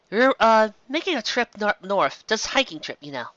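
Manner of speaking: hiding the real plan; hesitant Twilight. Acted. Twilight evasively answers the bartender.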